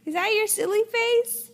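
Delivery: playful high voice. Real, not acted. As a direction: tease brightly, way up high. Parent teases toddler's face.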